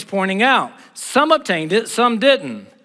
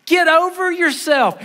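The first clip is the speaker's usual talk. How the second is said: sharp high rebuke, pushed out; high forceful rebuke; 10 semitones higher than usual. Acted. Preacher rebuking Elijah's self-pity, high and forceful. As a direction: scold him, high and hard.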